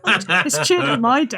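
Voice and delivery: laughing through the words, slow; real laughter. Real, not acted. Player amused by the story, real laughing speech. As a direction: talk while cracking up.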